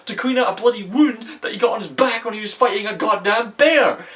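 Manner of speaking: high, heated ranting; angry rant. Real, not acted. Reviewer venting about the waterfall scene, heated. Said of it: "I'm sorry if I'm getting mad here".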